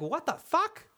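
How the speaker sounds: sudden high squeaky disbelief; high squeak. Retold or imitated. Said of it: "he didn't say it like that".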